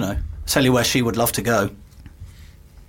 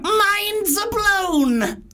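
very high, long-held mock-amazed cry; piercing squeal; much slower than usual. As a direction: squeal it slowly so it goes right through them. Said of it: "that really goes through me".

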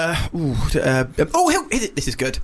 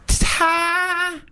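put-on very high, drawn-out child's punchline; four-year-old girl; 12 semitones higher than usual. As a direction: proudly stretch it out in a little-girl squeal. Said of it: "she's about four or something".

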